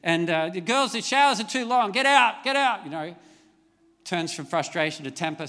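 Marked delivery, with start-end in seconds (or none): 0.5-2.8 higher, louder exasperated yell; retold shout down the house; frustrated yell. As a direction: yell it out like a fed-up parent. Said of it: "I yell out".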